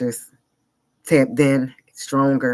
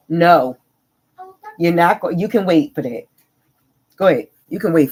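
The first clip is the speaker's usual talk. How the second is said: loud, higher firm command; 5 semitones higher, 6 dB louder than usual. Real, not acted. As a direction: parent snapping at an interrupting daughter. Telling daughter to wait.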